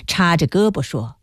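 ordinary delivery

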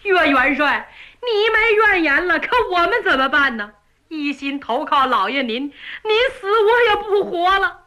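put-on a servant boy near tears for his master; tearful servant. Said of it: "王恒一听就哭了".

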